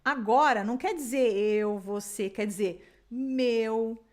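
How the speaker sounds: ordinary talk, nothing marked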